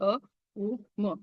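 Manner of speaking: "अ", "उ", "म" each sounded on its own; three separate sounds. Shown as a demonstration. Teacher showing Om's three utterances, sounding "अ", "उ", "म" separately. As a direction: break Om into "अ", "उ", "म" with gaps. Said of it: "तीन उच्चार असतात".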